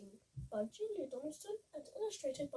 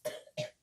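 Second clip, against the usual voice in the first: choking cough; hammed-up smoker's cough; spluttering cough. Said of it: "with a terrible cough".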